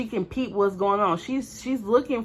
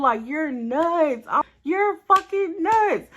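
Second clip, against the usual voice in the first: slow stunned disbelief; 7 semitones higher, slower than usual. Retold or imitated. Horrified at friend's attack.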